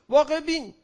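واقع بین lifted high and pointed against باسواد; stress on واقع بین. Real, not acted. Speaker insists on واقع بین over باسواد, stressed. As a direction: punch واقع, the pitch jumps up.

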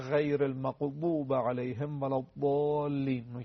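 slow recitation, ض in "المغضوب" and "الضالین" near a thick d; long "الضالین" with a heavy, d-like ض; dad like heavy d. Shown as a demonstration. Teacher showing how reciters say it, heavy d-like "الضالین". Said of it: "تقریباً مثل دال مفخم".

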